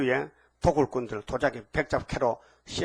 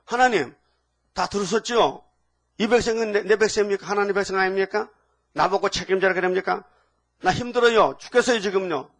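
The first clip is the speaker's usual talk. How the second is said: higher, louder protest to God; exasperated complaint; 4 semitones higher, 4 dB louder than usual. Acted. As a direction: plead as an overburdened Moses who has had enough. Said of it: "그 모세도 답답해".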